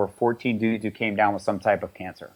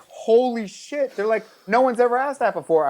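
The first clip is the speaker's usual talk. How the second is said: astonished doctors; 11 semitones higher than usual. Retold or imitated. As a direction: gasp it out like a stunned doctor.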